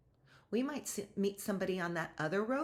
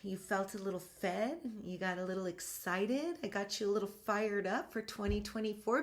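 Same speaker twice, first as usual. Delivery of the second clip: usual speaking voice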